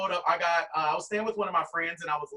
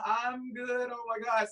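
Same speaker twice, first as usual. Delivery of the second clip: nervous; 5 semitones higher, slower than usual. Retold or imitated. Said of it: "being nervous".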